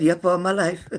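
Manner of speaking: laughing through the words. Real, not acted. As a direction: read it out while cracking up. Reading a chat reply, laughing.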